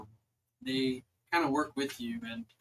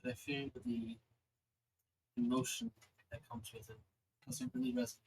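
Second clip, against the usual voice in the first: lightly voiced celestial being, softer and paused; a gently understated goddess, with long pauses between phrases; 9 semitones higher, 9 dB quieter than usual.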